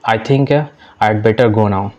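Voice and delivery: slow, careful classroom English; deliberate teacher read-aloud; slow model reading. Shown as a demonstration. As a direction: spell each word out slowly for learners. Teacher reads model sentence.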